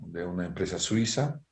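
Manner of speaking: ordinary delivery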